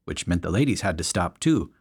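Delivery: ordinary delivery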